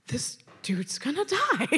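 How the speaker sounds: hushed aside in disbelief; retold whisper. Two girls watching him go, retold whisper. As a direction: whisper it as a grim prediction.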